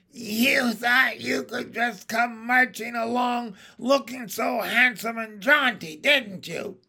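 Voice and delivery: high, witchy berating; scornful hag. A narrator's reading. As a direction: sneer at him as an angry witch. Hag berating absent victim.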